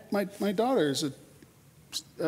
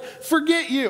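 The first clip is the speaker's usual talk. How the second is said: higher, louder dismissive brush-off; saying it out loud; 6 semitones higher, slower than usual. Retold or imitated. Stressed, snapping at someone not aligning, higher dismissive brush-off. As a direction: toss it right at them. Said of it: "you're just like".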